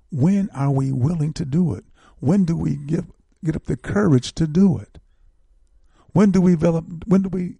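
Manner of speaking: fiery call to the community; rising exhortation. Real, not acted. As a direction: preach it, climbing in force. Host exhorts listeners, rising.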